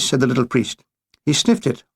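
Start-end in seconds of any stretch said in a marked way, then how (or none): none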